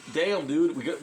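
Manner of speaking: ordinary talk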